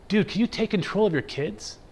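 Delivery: fed up, turning round to complain; annoyed bus passenger scolding a father; irritated demand. Retold or imitated.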